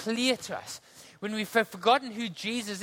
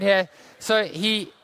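ordinary delivery

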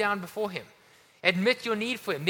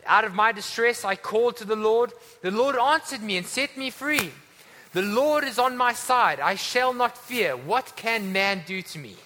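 higher, forceful psalm proclamation; scripture declamation; 4 semitones higher, 5 dB louder than usual. Acted. Preacher reads what Jesus sang before Gethsemane, declaimed. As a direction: ring out each line of the psalm.

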